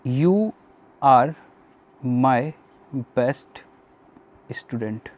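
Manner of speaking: each word spaced out as he writes; teacher to students, dictating the example word by word; slow dictation. Shown as a demonstration. Slow, spaced dictation. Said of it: "एक sentence लिखा मैंने".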